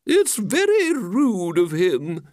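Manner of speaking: high, slow, drawn-out complaint; offended lady. Acted. She complains about him spoiling the fun, high and offended. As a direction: protest at length in a high voice. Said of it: "she said".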